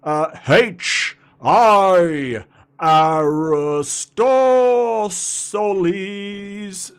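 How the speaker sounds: loud, high, drawn-out hype announcer. Pretend. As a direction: boom the names out like a ring announcer. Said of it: "I do an announcer voice".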